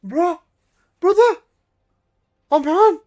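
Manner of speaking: high, loud excited shouting; excited yells. Real, not acted. Streamer yelling at chat in pure hype, loud and high. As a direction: yell it out, can't believe it. Hyped reaction to big gift.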